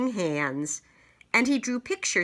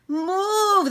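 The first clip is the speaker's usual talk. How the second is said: high loud shout; 7 dB louder than usual. Acted. Knights shouting at boy to move. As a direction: yell it at the boy in alarm. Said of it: "they shouted".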